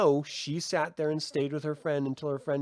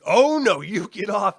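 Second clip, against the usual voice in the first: higher and much louder, fierce; defiant yell; 5 semitones higher, 8 dB louder than usual. Retold or imitated. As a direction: shove the monster off your friend. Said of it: "and she's like".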